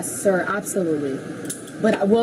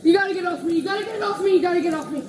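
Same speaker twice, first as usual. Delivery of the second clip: repeated, higher, frantic, near tears; panicked pleading; 10 semitones higher than usual. Real, not acted. Pinned woman crying at officers to get off her, panicked.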